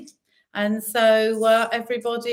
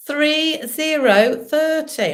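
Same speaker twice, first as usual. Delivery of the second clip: bingo caller; 5 semitones higher, 5 dB louder, much slower than usual. Acted. Bingo calling numbers.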